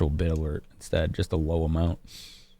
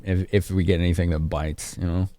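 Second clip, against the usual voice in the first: ordinary talk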